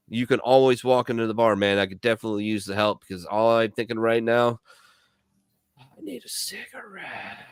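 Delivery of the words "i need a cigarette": slow, much softer, higher craving moan; craving sigh. Acted. Man at the bar aching for a smoke, soft slow moan.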